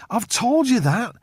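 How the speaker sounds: high, slow, rattled protest; losing composure, voice climbing; a man losing his grip; control slipping. A narrator's reading. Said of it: "his control suddenly slipping".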